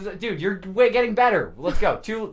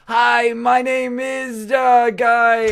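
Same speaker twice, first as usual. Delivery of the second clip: high, very slow, drawn-out mock introduction; mock halting attempt; 6 semitones higher, 5 dB louder, much slower than usual. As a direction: stretch each word out like a bad accent attempt. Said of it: "If you're just going like".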